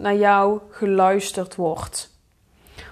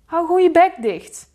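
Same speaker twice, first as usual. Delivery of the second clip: higher, louder snapped shut-up; angry retort; 8 semitones higher, 5 dB louder than usual. Retold or imitated. Fed up with sibling's remark, a sharp angry snap. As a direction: bite back at the sibling. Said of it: "denk je dan".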